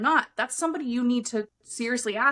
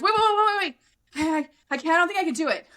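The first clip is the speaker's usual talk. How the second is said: high, louder stammered wait wait; panicky backing out; 6 semitones higher, 3 dB louder, faster than usual. Retold or imitated. Client about to scream, panicky high pleading. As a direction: imitate a client losing her nerve. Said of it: "She goes".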